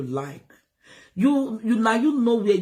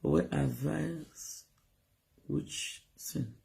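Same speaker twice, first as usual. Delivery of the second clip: slow, much softer aside; scornful mutter; 6 semitones lower, 11 dB quieter, much slower than usual. Real, not acted. Vlogger dismissing critics, soft scornful mutter. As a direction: mutter it under your breath.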